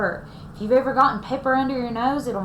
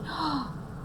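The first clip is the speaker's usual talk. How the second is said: gasp; 4 semitones higher, 10 dB quieter than usual. Acted. Reading Bear Snores On aloud.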